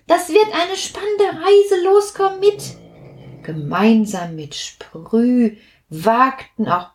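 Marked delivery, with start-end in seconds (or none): 0.1-2.8 eager invitation. Acted. Sprüh urges the others along.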